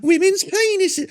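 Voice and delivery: high, slow sneering mock voice of a comic; hammed-up mock comic voice, high and drawn out; mocking comedian impression.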